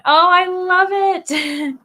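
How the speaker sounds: high, loud, drawn-out gush; stretched-out happy cry; delighted. Real, not acted. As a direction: squeal with delight, slowly. Reacting to a comment.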